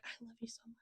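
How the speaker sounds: very soft, barely voiced mock love confession; hushed confession. Couple confessing love to each other, imitated in a hush. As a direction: breathe it like a secret between lovers.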